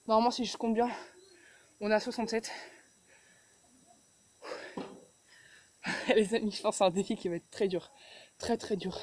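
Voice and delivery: loud and winded between reps; doing push ups. Real, not acted. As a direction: call out the count after the push-ups. Resting after a set of push-ups.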